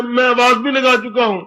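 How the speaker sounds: usual speaking voice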